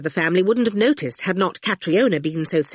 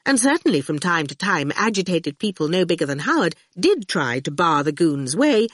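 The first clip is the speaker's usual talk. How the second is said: ordinary delivery, nothing marked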